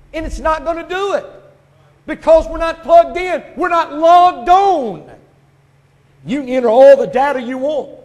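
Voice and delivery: high, booming preacher's shout; full-force preaching. Real, not acted. Preacher warning that we're not plugged in, full-force. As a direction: thunder it at the congregation, drawing out the key words.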